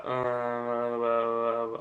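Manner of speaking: long, droning dazed "uhhh"; out-of-it groan. Retold or imitated. Partner mimicking the groggy patient after surgery, dazed droning groan. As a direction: imitate someone too drugged to answer, in one drawn-out monotone. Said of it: "You were legit just like this".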